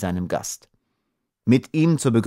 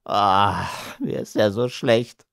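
queasy, miserable moan; nauseous little man's lament; sick groaning; slower than usual. A narrator's reading. Dwarf sick, clutching his belly.

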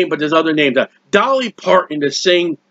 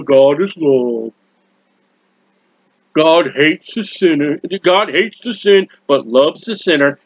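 lower, slow, lightly mocking recitation; mocking false teaching; 4 semitones lower, slower than usual. Preacher mocking a slogan he rejects. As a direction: parrot the slogan with scorn. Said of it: "don't get with that mess".

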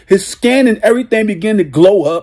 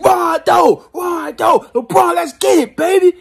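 high shouted chant of the names; fan cheer; 10 semitones higher than usual. Retold or imitated. Fan in the arena cheering when the team comes on, high shout. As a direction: jump out of the seat cheering. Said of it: "we be jumping out my seat".

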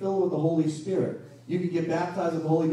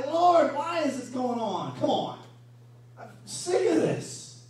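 exasperated complaint; 7 semitones higher, slower than usual. Retold or imitated. Exasperated believer complains to God.

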